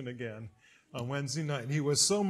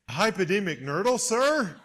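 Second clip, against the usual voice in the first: louder, rising incredulous query; innocent question; 5 semitones higher, 7 dB louder than usual. Retold or imitated. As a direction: ask it back with barely held laughter. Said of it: "I said".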